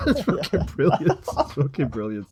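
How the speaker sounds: talking through laughter; real laughing delight. Real, not acted. Host delighted by the severed head reveal, laughing. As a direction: crack up while praising it. Cracking up after his own scream.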